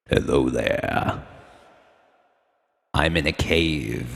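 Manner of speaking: slow, deep, echoing voice in a cave. Shown as a demonstration. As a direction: talk slowly into the reverb like a cave. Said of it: "That's really reverby".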